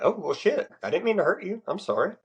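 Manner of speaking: acting out a surprised, remorseful response; apologetic reply. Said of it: "You'd be like".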